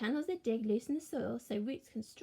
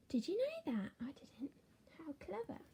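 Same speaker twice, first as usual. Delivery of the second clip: gasping hushed wonder; amazed whisper; 4 semitones higher, 5 dB quieter than usual. Acted. Teacher marvels to the children in a whisper.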